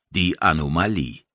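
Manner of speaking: plain reading voice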